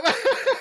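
sudden high loud laugh; loud burst of laughter. Real, not acted. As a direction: crack up out loud.

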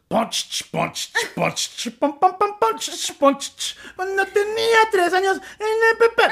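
mouthed drum-and-cymbal beats, then high wailed Spanish vocals; beatbox a night band and wail along; high-pitched mock Mexican music; imitated street music. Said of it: "can you play the instrument".

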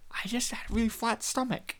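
hushed, near-whispered mock excuse; very soft, half-whispered; sheepish excuse. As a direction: murmur a feeble cover story. Acted sheepish excuse to friends.

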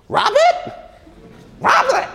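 very high, loud American call across the house; hammed-up American accent, shouting for her husband; American secretary calling out. Secretary calls Robert to the phone in a high American shout. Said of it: "I'm not gonna do any more American accents".

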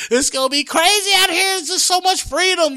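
high, loud, gushing enthusiasm; hyped-up sales pitch. Acted. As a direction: oversell the trip in a high voice. Pitches Hawaii trip in acted quote.

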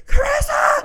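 very high, loud imitated yell for help; freaking out. Dad calling wife down to help, freaking out. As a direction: shriek the name like a panicked partner. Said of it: "he's freaking out".

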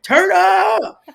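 high, loud, whooping party cry; mock reveler hollering over the noise; party shout. People too busy partying to hear God, party shout. Acts out noisy party crowd.

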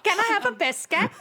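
put-on high, prim child voice; a polite little boy asking for a treat; mock toddler. Cohost joining the joke about Jemmy. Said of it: "continuing the Jemmy bit".

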